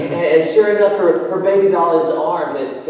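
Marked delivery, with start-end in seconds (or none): none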